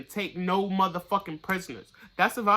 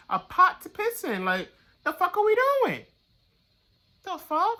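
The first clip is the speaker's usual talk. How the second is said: incredulous outburst; 13 semitones higher, 6 dB louder than usual. Real, not acted. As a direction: pitch shoots way up, louder, disbelieving "the fuck". Outraged at bad advice-givers.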